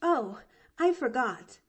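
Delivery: put-on high, eager little girl; young girl voice. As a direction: chirp it out like a bright chatty child. Pollyanna remembers.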